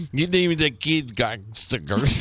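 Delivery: slurred mock reading breaking into laughter; Benicio del Toro. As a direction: mangle the words and crack up at the end. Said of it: "Benicio del Toro".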